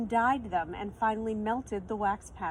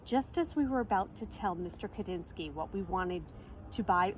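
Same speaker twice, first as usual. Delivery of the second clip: ordinary delivery, nothing marked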